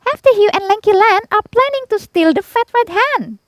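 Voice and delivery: very high, loud tiny-bug voice; ladybird reporting. A narrator's reading. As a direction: squeak the news urgently to the animals. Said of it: "told the animals what she'd heard".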